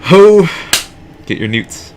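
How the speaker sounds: loud high street-vendor cry; calling out. Acted. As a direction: shout it out like a hawker. Troll girl calling out in game.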